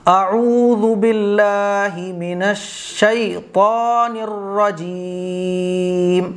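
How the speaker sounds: slow, drawn-out chanted recitation; Quranic recitation. Shown as a demonstration. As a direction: recite it in full tajweed. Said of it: "کیسے پڑھیں گے".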